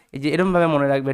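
ordinary talk, nothing marked

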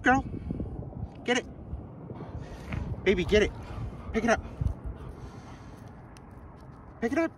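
high, soft coaxing of the dog. Real, not acted. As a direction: coax the dog up high and light.

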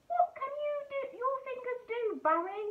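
high, excited little fish voices; eager fish. Acted. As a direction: squeak it out like curious fish. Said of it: "they asked".

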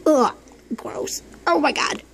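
ordinary delivery

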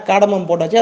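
ordinary delivery

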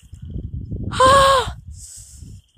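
sudden shocked intake of breath; sharp gasp from cold. Real, not acted. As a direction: gasp at icy water. Stepping into icy pond.

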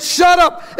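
high, sharp mock shout; crowd's rebuke. Crowd silencing a blind beggar, high mock shout. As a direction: act out a crowd shouting someone down.

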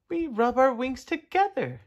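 high-pitched little insect voice; katydid voice. A narrator's reading. Katydid figure answers Tudley.

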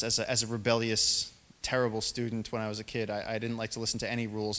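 normal talking voice

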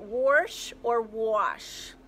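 very slow, warsh then wash. Shown as a demonstration. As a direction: contrast warsh and wash slowly. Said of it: "How do you say it".

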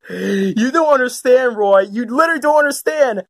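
ordinary talk

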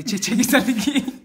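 high, loud goofy outburst; excited. Acted. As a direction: blurt it out wildly. Prank caller goofy outburst.

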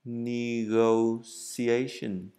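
"Negotiation" drawn out very slowly, every syllable spaced; slow teacher demo of "Negotiation"; slow model pronunciation. Shown as a demonstration. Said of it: "mình sẽ phiên âm".

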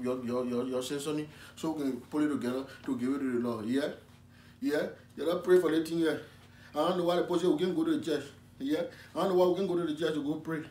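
put-on loud, fervent West African preacher accent; comic West African accented preaching; West African pastor.

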